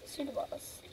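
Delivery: very soft, near-whispered repeat; hushed echo. Real, not acted. Player repeating who is boss to herself, hushed. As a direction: drop it to a murmur. Muttered echo of own remark.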